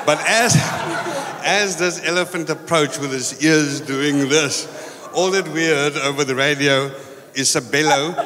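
ordinary talk

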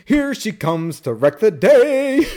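high, slow, drawn-out mock fanfare; imitated courtroom announcement. Quoting him as Samantha arrives late, mock announcement. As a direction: announce her grandly, stretching every word. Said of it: "and he goes".

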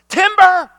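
high, very loud holler; sudden booming cry of alarm; warning shout. Acted. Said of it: "Why do people shout".

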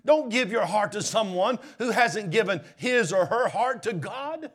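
loud, high full-force preaching; pulpit-pounding sermon voice; fiery preaching. Acted. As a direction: preach it out to the whole church.

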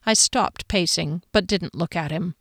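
usual speaking voice

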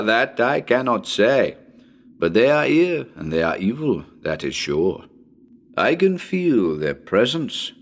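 put-on a grave, ominous French-accented psychic; Professor Mignon's French accent. Psychic professor addressing radio listeners before his tour, grave French accent. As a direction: speak as a solemn French ghost-hunter warning of evil. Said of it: "here is the professor to say a few words to you".

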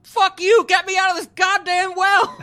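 high, loud hammed-up rage; mock furious shout. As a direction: yell like an enraged captive, imitating her. Voicing captive turning back to fury.

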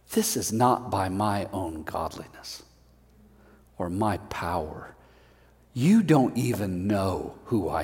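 low, soft, slow Peter; quiet Peter to the crowd. Acted. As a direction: lay it down gently and firmly.

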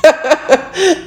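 high giggle at herself; laughing at her own weight gain joke. Real, not acted. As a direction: let a quick high laugh slip out.

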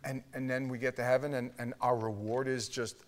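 ordinary delivery

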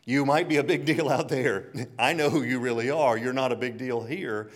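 loud mock scolding mother; a mother scolding her star son, mock stern and loud; scolding mom. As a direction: play the mom putting her famous son in his place, loudly. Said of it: "his mom says".

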